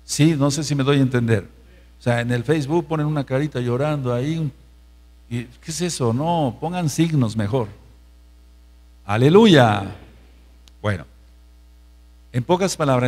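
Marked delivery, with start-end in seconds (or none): none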